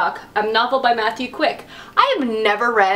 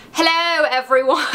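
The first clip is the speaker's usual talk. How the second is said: a hint of a British accent on hello, everyone; lightly mock a posh British greeting; bad British accent; 3 semitones higher than usual. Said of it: "I'm really good at British accents".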